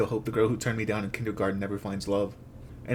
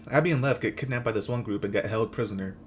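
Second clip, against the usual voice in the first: ordinary talk, nothing marked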